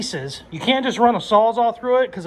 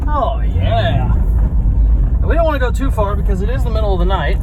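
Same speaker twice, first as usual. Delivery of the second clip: excited; 2 semitones higher, 8 dB louder than usual. Real, not acted. First drive, thrilled and loud.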